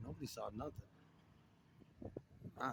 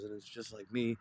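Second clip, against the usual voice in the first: usual speaking voice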